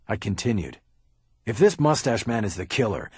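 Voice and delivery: plain reading voice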